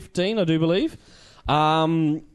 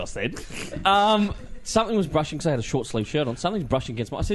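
ordinary delivery

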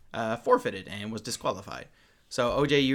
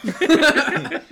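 ordinary talk

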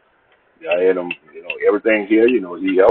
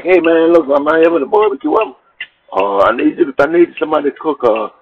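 retold hustler pitch, raised and quick; Stanley's pitch; 9 semitones higher than usual. Stanley begging the speaker to cook, retold in a raised, quick voice. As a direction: sell the cooking job to him.

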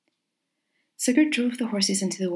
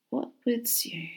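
slow, hushed musing; soft slow murmur; 4 semitones higher, 7 dB quieter, slower than usual. Acted. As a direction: murmur it slowly to yourself. Stranger muses, bowed, to himself.

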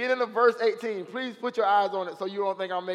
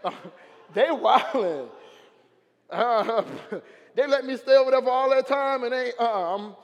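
excited Legion; 3 semitones higher, 5 dB louder than usual. Acted. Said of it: "And Legion's like".

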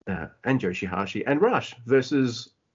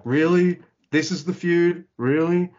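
higher, questioning disbelief, re-enacted; incredulous; 6 semitones higher, 3 dB louder than usual. Fan doubting the feud a month ago, retold incredulous questioning. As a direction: mimic your own sceptical reaction.

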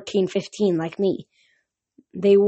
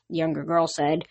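usual speaking voice

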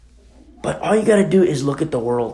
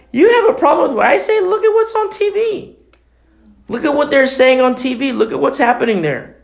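high-pitched indignant protest; incredulous retelling of an argument, high; indignant retort; 10 semitones higher than usual. Retold or imitated. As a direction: throw it back at the critics, voice pitched way up. Retells confronting critics over their TV.

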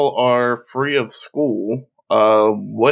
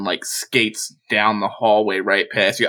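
ordinary delivery

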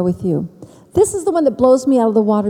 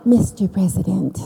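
Marilyn Monroe impression; 2 semitones lower than usual. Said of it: "Marilyn Monroe".

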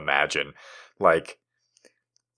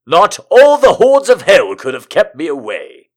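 loud, high heroic declaration; heroic vow; 8 semitones higher, 11 dB louder than usual. A narrator's reading. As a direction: declaim it like a pulp hero. Hero's vow in the story.

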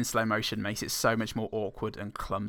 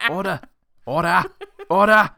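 higher and louder each time, building to a roar; judge shouting order; 9 semitones higher, 12 dB louder than usual. Retold or imitated. Judge silencing the court, yelling louder each time. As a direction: bang the gavel with your voice. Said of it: "the judge will just be".

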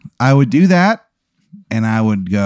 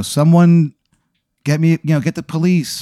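raised voice calling out for help; half-hearted yell for help; mock call for help; 6 semitones higher than usual. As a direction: shout to strangers in the street to fetch the police. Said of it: "And I go".

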